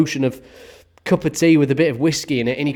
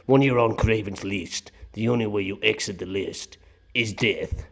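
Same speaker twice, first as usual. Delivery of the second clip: put-on low, accented movie-villain line; ominous film threat; 5 semitones lower, 5 dB quieter than usual. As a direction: deliver a grave threat in a put-on voice. Quotes the film's ominous threat line.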